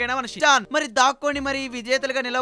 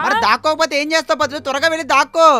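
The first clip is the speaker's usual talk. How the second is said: urgent loud call; 5 semitones higher, 8 dB louder than usual. Acted. Host urges Patlu to hide.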